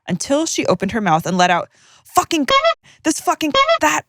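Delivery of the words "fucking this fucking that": broad, harsh New Zealand swearing, pitched up high; harsh Kiwi accent. Retold or imitated. Quoting the friend's nan in a harsh Kiwi accent. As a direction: rasp it out like a tiny Kiwi nan. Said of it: "the harshest Kiwi accent you can imagine".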